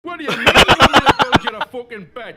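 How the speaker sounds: loud, indignant shout; outraged shout. Acted. Wanderer yelling at the man demanding beds, outraged shout. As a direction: bellow it in disbelief.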